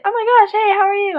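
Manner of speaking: high, loud delighted greeting; excited. Retold or imitated. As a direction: greet her with sudden delight. Guy recognizes her, delighted.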